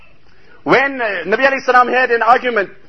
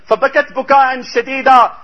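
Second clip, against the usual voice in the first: fast Arabic recitation; 3 semitones higher, 3 dB louder, much faster than usual. Real, not acted. Hadith quoted in Arabic.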